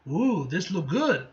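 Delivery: plain reading voice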